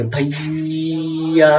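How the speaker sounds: भैया stretched very slowly; drawn-out call. Shown as a demonstration. Teacher calling a brother down the lane, भैया stretched slowly. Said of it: "ऐसे चिल्लाते भैया".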